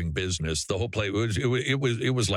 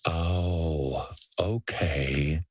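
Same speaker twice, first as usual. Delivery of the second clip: sarcastic okay; 6 semitones lower, much slower than usual. Real, not acted. Sarcastic mock acceptance.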